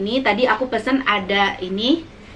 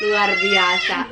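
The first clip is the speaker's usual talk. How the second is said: mouth burning from spicy food; much slower than usual. Real, not acted.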